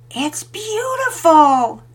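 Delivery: drawn-out gasp of wonder; girl amazed by a necklace; delighted. A narrator's reading. Girl admiring locket.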